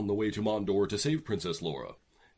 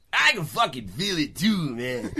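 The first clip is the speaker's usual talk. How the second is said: brash Laura voice; 8 semitones higher, 7 dB louder than usual. Acted.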